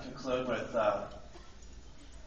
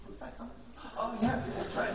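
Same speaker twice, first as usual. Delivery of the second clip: mock puzzled girl; 10 semitones higher than usual. Acts out girl wondering about date.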